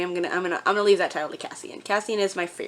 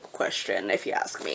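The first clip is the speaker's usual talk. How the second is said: low aside; 13 semitones lower, 7 dB quieter than usual. Real, not acted. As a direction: drop to a deep ominous aside. Joking aside about loaded question.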